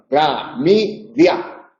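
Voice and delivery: Clamidia drawn out slowly, each syllable apart; slow syllable demo. Shown as a demonstration. Teacher slowly syllabifying Clamidia for students.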